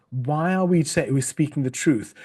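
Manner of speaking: ordinary delivery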